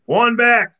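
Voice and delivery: loud, high triumphant shout; a fisherman's loud victory yell. A narrator's reading. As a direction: crow it over the water. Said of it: "yells Charlie".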